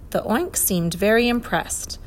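usual speaking voice

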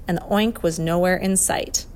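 ordinary delivery

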